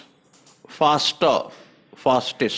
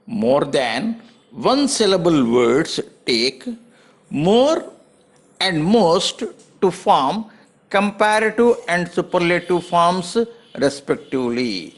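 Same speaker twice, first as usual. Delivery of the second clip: slow, word by word; slow dictation; much slower than usual. Shown as a demonstration. Teacher dictating the rule to students, very slow. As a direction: spell the rule out slowly for copying.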